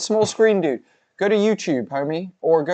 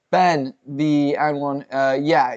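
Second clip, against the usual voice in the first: ordinary talk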